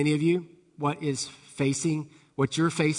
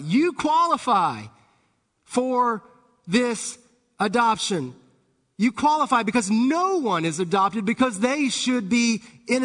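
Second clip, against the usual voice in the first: higher-pitched, urgent sermon delivery; impassioned preaching; 6 semitones higher, 3 dB louder than usual. Real, not acted. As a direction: preach it with conviction, stressing key words. Preaches with rising force.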